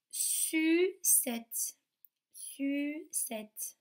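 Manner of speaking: "sucette" very slow, split su, cette; slow sound demonstration. Shown as a demonstration. Teacher tests children on ou, slow syllable-by-syllable "sucette". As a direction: hold the French u in "sucette", then cette. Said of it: "Est-ce qu'on entend ou".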